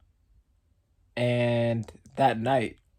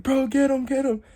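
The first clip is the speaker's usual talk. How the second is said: hyped cheering; 11 semitones higher than usual. Retold or imitated. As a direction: pump up the crowd. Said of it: "we're like".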